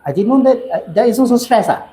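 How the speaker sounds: very high, eager yes-yes reply; patient's eager reply. Retold or imitated. Patient realizing expectation is stress, very high eager reply. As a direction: gush like a surprised patient. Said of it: "they ask".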